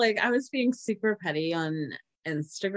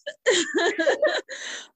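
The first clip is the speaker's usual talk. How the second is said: high giggle; 11 semitones higher than usual. Real, not acted. Loud high laugh.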